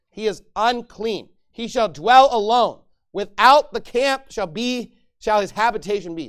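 loud, slow pulpit proclamation; preaching at full force. Acted. Pastor thundering Leviticus at the congregation, loud and slow. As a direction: bear down on each word, slowly. Scripture reading builds to preaching.

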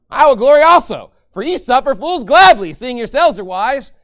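loud, proud proclamation; boastful. Acted. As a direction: declare it like a bragger. Reads Paul's boast loudly.

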